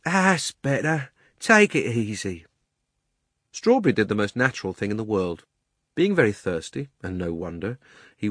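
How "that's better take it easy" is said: slow, drawn-out coaxing character voice; soothing cabby. A narrator's reading. Cabby calming Strawberry after the chase, slow and soothing. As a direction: settle the horse with slow reassurance.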